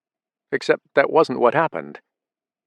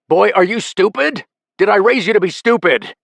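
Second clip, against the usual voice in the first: high, loud scolding; berating; 10 semitones higher, 6 dB louder than usual. A narrator's reading. Father berates son for stupidity, loud scolding. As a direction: tear into him.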